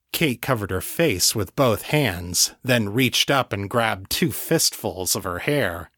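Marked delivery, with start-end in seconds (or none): none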